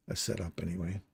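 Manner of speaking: ordinary talk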